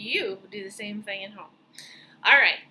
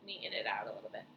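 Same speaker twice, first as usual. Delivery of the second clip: ordinary talk, nothing marked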